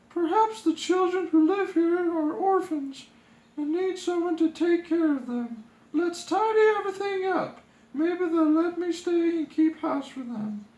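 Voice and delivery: high, bright-toned princess voice; sweet high princess, eager to help; cheerful princess. Acted. Princess voice held.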